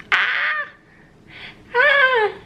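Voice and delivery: very high squeal of delight; playful squeal. Real, not acted.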